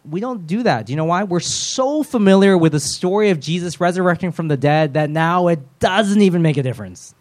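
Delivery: normal talking voice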